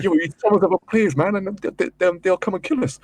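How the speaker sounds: high, urgent warning to a friend; retold freaking out. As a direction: act out panicked alarm. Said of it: "I was really freaking out".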